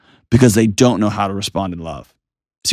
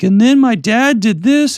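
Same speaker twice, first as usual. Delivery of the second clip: put-on much higher, slow whiny kid voice; grown kid complaining; 13 semitones higher, slower than usual. Grown child to counselor, high whiny mock complaint. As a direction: mimic the kid telling the counselor. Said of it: "be like".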